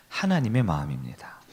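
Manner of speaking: whisper. Real, not acted. Preacher drops to emphasize.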